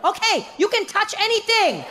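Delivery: cheery guide. Retold or imitated. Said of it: "He's like".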